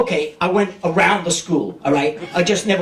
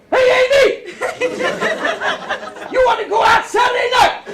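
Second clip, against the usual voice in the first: Brooklyn street call. Pretend. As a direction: holler it up at her window. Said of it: "the old-fashioned Brooklyn way".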